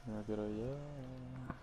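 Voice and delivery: weary drawn-out sigh. Real, not acted. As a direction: drag it out wearily. Tired, wants the game to end.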